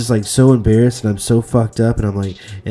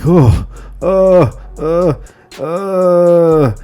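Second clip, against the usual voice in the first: higher, louder mock moans of relief; imitated moaning; 8 semitones higher, 6 dB louder, much slower than usual. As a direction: ham up the relief moans at the urinal.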